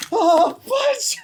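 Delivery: startled shriek. Real, not acted. Streamer's balloon slips, startled high shriek. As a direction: yelp in surprise as it goes wrong.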